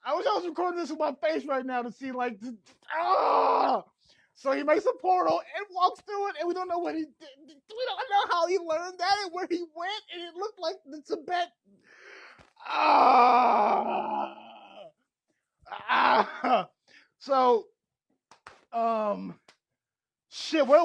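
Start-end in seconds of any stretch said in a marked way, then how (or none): 3.0-3.8 loud delighted shriek; excited scream. Real, not acted. Thrilled scream. 12.7-16.7 loud delighted shriek; excited scream. Real, not acted. Thrilled scream.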